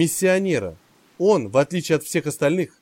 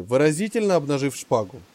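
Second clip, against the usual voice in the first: ordinary delivery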